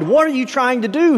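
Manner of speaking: mild yell. A narrator's reading. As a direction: voice a yelled demand without full volume. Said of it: "he yelled".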